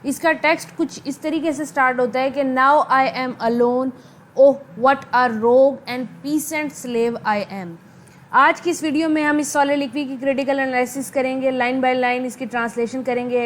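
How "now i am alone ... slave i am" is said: slow, measured verse recitation; soliloquy reading. Acted. Teacher reads Hamlet's soliloquy to students, slow recitation. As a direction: read it out slowly, word by word.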